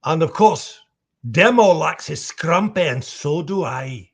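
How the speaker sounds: cheery Scottish brogue; lively Scottish drinker, punched up front; Demo. Acted. Demo telling fans he likes scrumpy, lively Scottish brogue. Switches to Demo's voice.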